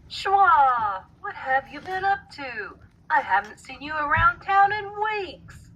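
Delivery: a friend calling out happily; exclaiming. A narrator's reading. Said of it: "he exclaimed".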